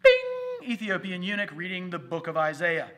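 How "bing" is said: high, loud bing sound; sudden bing. Acted. Pastor marking God's surprise, high and loud. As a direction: pop it out like a surprise.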